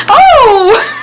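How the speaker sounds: high, drawn-out hoot with laughter; exasperated "oh". Real, not acted. Storyteller vents at his denial, exasperated. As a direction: let out a high exasperated cry. Vents after saying more mad.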